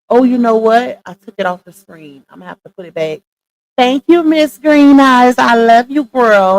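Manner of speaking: ordinary talk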